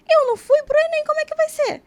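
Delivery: high, anxious inner voice; mock panic. Retold worried thought.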